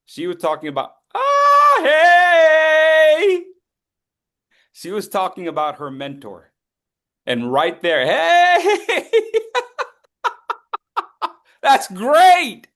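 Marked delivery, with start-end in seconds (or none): 1.1-3.4 sudden high, loud cheer of recognition; excited shout. Real, not acted. 8.1-12.7 high, loud delighted whoop and laugh; laughing cheer. Real, not acted.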